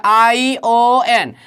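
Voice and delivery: each letter drawn out slowly, ION spelled as आई ओ एन; slow spelling. Shown as a demonstration. Teacher spelling ION slowly for students. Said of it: "टी के बाद क्या है?".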